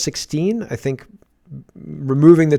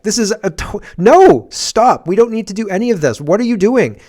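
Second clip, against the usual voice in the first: higher and louder, frantic objection; exasperated protest; 7 semitones higher, 6 dB louder than usual. Retold or imitated. Patient protesting the doctors, exasperated. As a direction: stop them, fed up and frantic. Ranting at absurd hospital scene.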